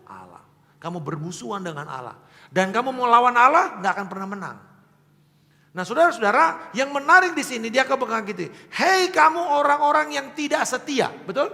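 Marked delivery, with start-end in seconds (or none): 2.5-11.5 louder and higher, rising to a fervent cry; a preacher calling out the unfaithful; preaching at full force. Acted. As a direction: thunder the warning at the congregation. Preacher building to climax.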